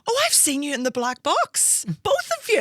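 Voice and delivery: rising very high and loud into "both of you"; imitating the doctor's delighted recognition; doctor excited. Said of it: "the doctor went".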